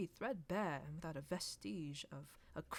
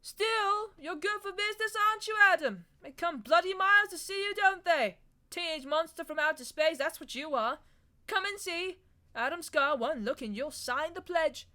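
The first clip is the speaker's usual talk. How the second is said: loud, high mocking shout; shouting across the bar; 11 semitones higher, 12 dB louder than usual. A narrator's reading. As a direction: jeer it across the room at him. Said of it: "he shouted to Scar".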